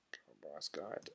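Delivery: very low, barely audible mumble; muttering to himself. Real, not acted. Streamer trying to recall a guide's name, muttering to himself.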